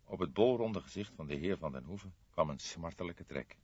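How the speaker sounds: ordinary delivery, nothing marked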